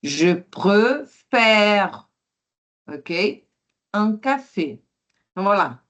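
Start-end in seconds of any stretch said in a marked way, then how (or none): 0.0-2.1 Je préfère very slow, open è held; careful slow je préfère. Shown as a demonstration. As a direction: draw out préfère syllable by syllable. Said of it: "É o I comme ça".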